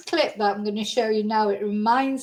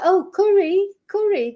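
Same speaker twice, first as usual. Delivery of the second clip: imitating a sketch character; 9 semitones higher, 4 dB louder than usual. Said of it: "It's like".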